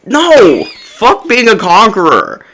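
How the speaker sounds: high, drawn-out rejection; fed-up, emphatic refusal; indignant retort. Acted. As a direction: shoot it down in exasperated disbelief.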